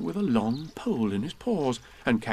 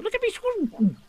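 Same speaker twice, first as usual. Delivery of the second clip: little kangaroo showing off swimming; squeaky Roo; slower than usual. Acted. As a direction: squeak it with glee.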